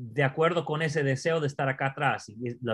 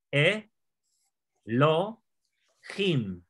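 E, lo, him with a pause between each; three separate syllables, E, lo, him; much slower than usual. Shown as a demonstration. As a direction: break the word into E... lo... him. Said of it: "¿Cuáles son las tres sílabas?".

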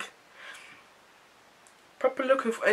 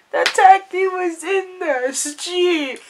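slow disbelief; 10 semitones higher, 7 dB louder, much slower than usual. Real, not acted.

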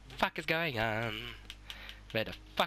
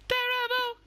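a high, loud cry of dread, half in jest; 4 dB louder than usual. Acted. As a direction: wail the word terrible at the screen. Game boss fight dread.

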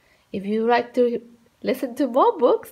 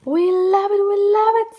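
put-on high, eager children's cheer; excited kids; 7 semitones higher, 4 dB louder than usual. Children begging the babysitter, high and eager. Kids beg for more rhymes.

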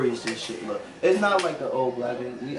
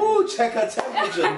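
laughing; 10 semitones higher than usual. Real, not acted. Teasing laugh in banter.

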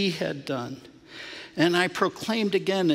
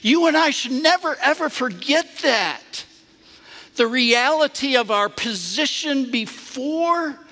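higher, louder, fervent preacher's telling; preaching at full force; 8 semitones higher, 5 dB louder than usual. Acted. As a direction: pour joy into the story as a sermon.

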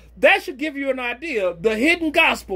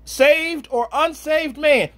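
usual speaking voice

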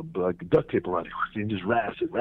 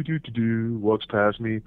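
ordinary talk, nothing marked

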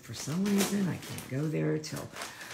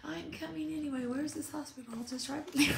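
higher, mock-stubborn demand; pushy mock insistence; 13 semitones higher than usual. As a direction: play someone who won't take no. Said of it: "sit there and go".

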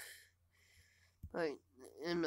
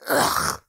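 harsh groan; 17 dB louder than usual. Real, not acted. Frustrated, voice failing.

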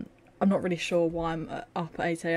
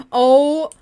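surprised shout; 8 semitones higher, 14 dB louder than usual. Real, not acted. Shocked at game moment.

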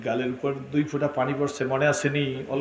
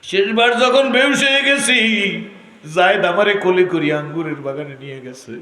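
melodic preaching lament; 13 semitones higher, 12 dB louder than usual. Acted. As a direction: stretch the line endings in a loud wail. Half-sung sermon lament.

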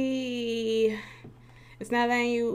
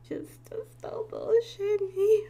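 whiny plea; 12 semitones higher, slower than usual. Acted. Pleading with the internet.

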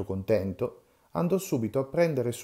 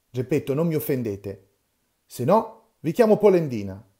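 loud, higher threatening warning; angry warning; 3 semitones higher, 7 dB louder than usual. Acted. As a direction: threaten him heatedly. Quarrel, threat of insult.

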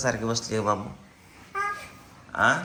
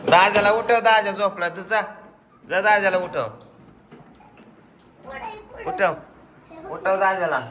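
eager urging; 8 semitones higher than usual. Real, not acted. Urging child to wake brother.

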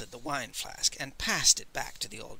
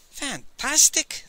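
much higher, slow, drawn-out praise; admiringly; 12 semitones higher, 8 dB louder, slower than usual. A narrator's reading. Master Li flatters the Old Man, high drawn-out admiration. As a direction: lay the flattery on thick. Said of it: "said admiringly".

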